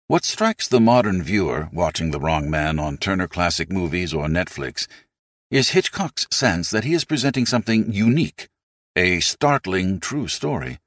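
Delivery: ordinary talk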